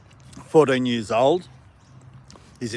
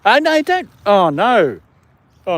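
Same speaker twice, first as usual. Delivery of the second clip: much higher, loud oh no; alarmed cry; 10 semitones higher, 10 dB louder, slower than usual. Real, not acted. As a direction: yelp as the dog snatches food. Dog grabs the food.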